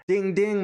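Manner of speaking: bright high bell call, ding ding; announcer calling the bell; boxing bell ding. Retold or imitated. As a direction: ring it out like a ring bell.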